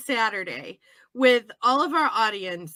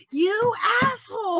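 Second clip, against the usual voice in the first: higher mock yell of outrage; retold screaming; 9 semitones higher than usual. Viewer screaming at the TV, retold outraged yell. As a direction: yell it at the character on screen. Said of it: "screaming at the T V".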